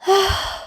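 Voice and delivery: put-on high soft childish sigh. Boy giving up, sighing softly. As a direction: breathe out a tired sigh. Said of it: "He sighs".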